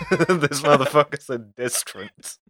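says it through laughter; laughing. Real, not acted. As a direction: laugh while you mock the slip. Laughing at the mispronunciation.

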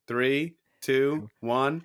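THREE, TWO, ONE spaced far apart; slow countdown. Real, not acted. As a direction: count "Three, two, one" slowly with a pause between numbers. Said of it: "Let's go slower than that".

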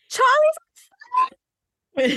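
high, loud panicked scream of the dog's name; retold scream. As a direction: shriek it like the aunt yelling from the kitchen. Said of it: "she screamed".

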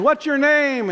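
high-pitched imitation of an insistent resident; care home resident. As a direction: call out the question like a resident. Voicing agitated care home residents.

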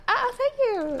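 awkward high thank you. Retold or imitated. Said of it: "I'm like".

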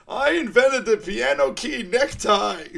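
high, loud, slow, laughing villain boast; villain bragging at the front, hammed-up; Mugatu quote. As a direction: boast it grandly while cracking up. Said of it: "he's like".

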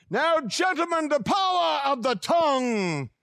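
put-on booming old-time orator. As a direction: declaim it like a grand pulpit orator. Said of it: "I can see him now standing. He was an orator".

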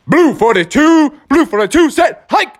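high, loud snap count; Froggy at the line calling hike; Froggy calling the play. Acted. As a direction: bark out the count.

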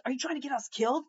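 fast, high, alarmed plea; frantic warning to a reckless friend; panicked friend. Retold or imitated. Buddies panicking at Will's rant.

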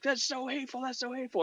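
high, mocking outrage; mock outraged protester. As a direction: sneer it like an offended protester. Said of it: "the way they say it is like very angry".

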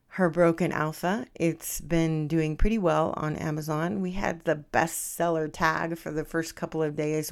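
sloppy casual talk. Real, not acted. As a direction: relax into casual slurry speech. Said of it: "now that I can get sloppy and slurry again".

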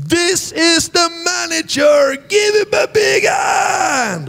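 high, slow, stretched-out shout; shouting to the crowd. Retold or imitated. Preacher introducing club owner to crowd, big shout. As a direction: hype up the manager like an MC.

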